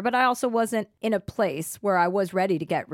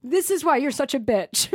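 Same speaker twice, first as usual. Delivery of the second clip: self-scolding; 5 semitones higher, 4 dB louder than usual. Retold or imitated. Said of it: "I was like".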